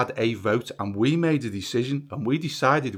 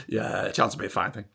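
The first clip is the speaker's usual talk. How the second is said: ordinary talk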